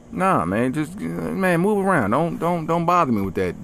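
ordinary talk

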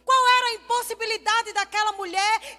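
impassioned preaching. Acted. Pastor to congregation about the impossible, impassioned preaching. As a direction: drive it home to the congregation, ringing out.

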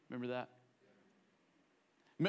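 ordinary talk

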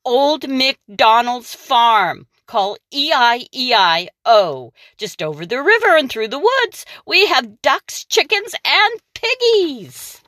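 lightly voiced cheery ad; ad read. Reading the witch's yellow-pages ad, lightly cheery. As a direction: sell the farm like an advert.

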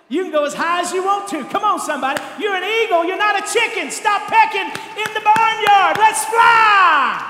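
loud, high rising preaching cry; preaching at full force. Acted. Pastor urging the church to soar like eagles, loud preaching. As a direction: lift the church up to the rafters.

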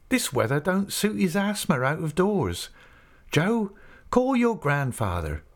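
put-on a young woman answering a visitor, then calling her boy; Minnie.

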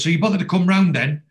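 high, loud acted reproach; indignant challenge. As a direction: confront the latecomer, imitating an annoyed partner.

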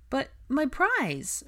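high, drawn-out little plea; plaintive child. A narrator's reading. A little boy asks George for his prize, plaintively. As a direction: plead slowly like a small boy.

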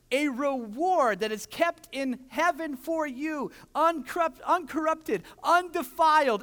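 loud, high preaching, slow and emphatic; full-force preaching. Acted. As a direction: proclaim it to the whole room, pressing each word. Preaching to congregation.

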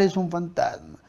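ordinary talk

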